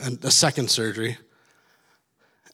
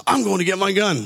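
higher-pitched mock threat; retold angry threat; 6 semitones higher than usual. Man in parking fight threatening the other, mock angry. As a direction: act out a heated threat.

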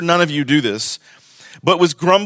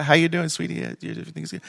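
much softer, offhand brush-off voice; distracted listener; 8 dB quieter, faster than usual. Congregant dodging talk of grumbling, mild offhand brush-off. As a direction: mimic a churchgoer half-listening and changing the subject. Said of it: "and you're like".

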